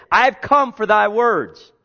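"I've come for thy words" cried out high, loud and slow; loud slow proclaiming. Acted. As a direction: proclaim it like a vow from the pulpit. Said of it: "Remember, it said".

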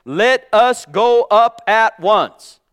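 slow, loud proclamation of Caleb's words; Caleb's call thundered out slowly; ringing quote. Retold or imitated. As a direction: declare it slowly like a battle call. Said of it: "and he said".

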